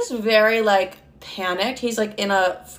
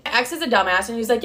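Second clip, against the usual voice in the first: ordinary delivery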